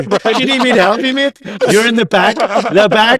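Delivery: high, loud teasing stewardess act; mock condescending stewardess. Stewardess sending the scruffy passenger to the back, mock condescending. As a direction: talk down to him like a hammed-up stewardess. Mocking stewardess voiced to tease friend.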